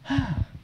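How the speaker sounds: tired professor sighs in the back of the car; big weary sigh. Retold or imitated. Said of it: "he let go a big sigh".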